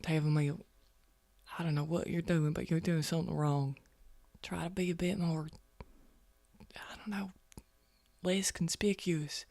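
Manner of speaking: hushed, very soft aside; quiet in-character warning. Acted. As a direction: keep it down, as if not to be overheard. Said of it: "kind of says quietly".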